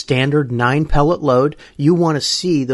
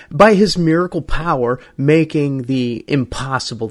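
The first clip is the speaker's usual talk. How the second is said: ordinary delivery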